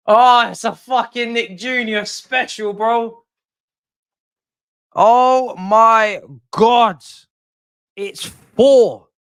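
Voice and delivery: high, loud disbelieving rant; exasperated outburst. Real, not acted. Gamer yelling at his losing team, exasperated and loud. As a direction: shout it up high, stretching the oh my God.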